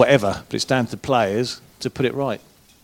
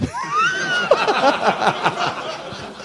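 high-pitched laughing fit; 3 dB quieter than usual. Real, not acted. Wet patch story.

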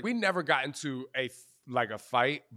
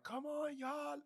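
high, strained, quieter mock yell; pitched way up in mock exasperation; retold exasperation; 10 dB quieter, slower than usual. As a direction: re-enact a fed-up trainer's plea. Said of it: "pissed because I'm like".

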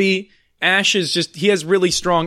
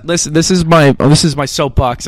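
raised voice, close on the mic; loud soapbox rant; 8 dB louder than usual. Pretend. Host starting a rant to fellow podcasters, loud and insistent. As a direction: mount the soapbox, much louder. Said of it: "I'm gonna get really close to the mic".

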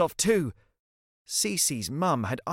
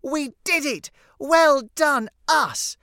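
put-on high, loud child's celebration; triumphant cheer; 11 semitones higher, 6 dB louder, slower than usual. Cece celebrating the dinosaur vanishing, triumphant. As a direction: cheer proudly in a high girl's voice.

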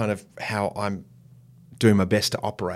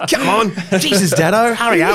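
much higher, louder impatient co-star; Georgie Parker; 12 semitones higher, 11 dB louder than usual. Retold or imitated. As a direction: snap at him to hurry. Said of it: "Until Georgie Parker says".

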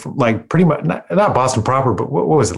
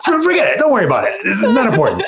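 high, dodging mock voice with a laugh; evasive New Bedford locals; 11 semitones higher, faster than usual. Guest imitates New Bedford locals avoiding whaling history, high mock dodge. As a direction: brush off the question like an embarrassed local. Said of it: "everybody from New Bedford's like".

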